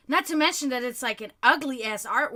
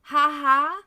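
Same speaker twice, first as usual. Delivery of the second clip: loud spoken "ha ha", no real laughter; mocking imitation of a guy's laugh; mock texted laugh; 6 dB louder, much slower than usual. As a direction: imitate his laugh as two punched words. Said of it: "And he's like".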